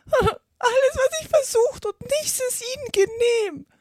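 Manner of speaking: very high, wailing bird voice; sobbing. A narrator's reading. Pigeon lamenting its failed efforts to Alice, sobbing. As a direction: sob out the complaint as a distraught pigeon. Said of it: "fuhr schluchzend fort".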